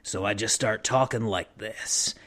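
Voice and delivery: gravelly, low and soft. Shown as a demonstration. Showing a game character's voice, low gravelly rasp. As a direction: drop into a low, quiet gravel. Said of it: "gave him kind of a gravelly, like, [name] type voice".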